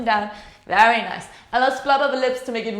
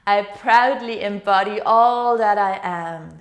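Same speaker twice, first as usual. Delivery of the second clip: slow, louder affirmation with every word weighted; proud affirmation; 3 semitones lower, 4 dB louder, slower than usual. Shown as a demonstration. Yoga teacher modelling the affirmation for the class, slow and proud. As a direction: declare it proudly, slowly. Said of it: "Why don't you say it with me?".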